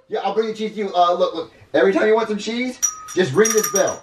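high, animated character voice; eager kid character. Acted. Junior gives his rat instructions, eager.

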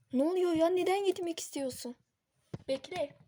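high, loud pleading cry; panicked yelling; desperate shout. Acted. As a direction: shout at her in disbelief. Kerem pleading with Yeşim.

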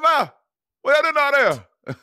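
high, loud, slurred mock call; imitating Bob's holler. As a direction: insist on the exaggerated impression of a co-host. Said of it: "to me, don't sound like that".

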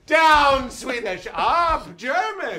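loud laughing recap; excited shout. Real, not acted. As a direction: shout it out, cracking up.

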